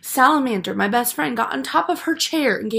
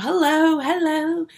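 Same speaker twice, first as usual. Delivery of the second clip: cheery waving hello; 7 semitones higher, slower than usual. Retold or imitated. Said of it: "I'm waving at the girls".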